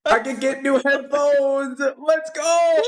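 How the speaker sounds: high, slow excited cheer. Real, not acted. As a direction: celebrate like you won something. Celebrating sub goal reached.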